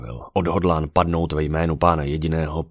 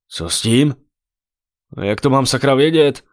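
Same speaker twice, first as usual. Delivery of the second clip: loud, high exasperated protest; flustered self-defence; defensive; 8 semitones higher, 7 dB louder than usual. A narrator's reading. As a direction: push the blame away, voice raised. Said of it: "bránil se Martin".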